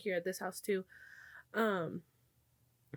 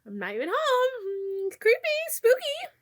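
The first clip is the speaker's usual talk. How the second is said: much higher, slow, hammed-up ghost voice; mock spooky voice; 13 semitones higher, 6 dB louder, slower than usual. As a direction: play it like a cartoon haunting. Mocking a ghost-story tone.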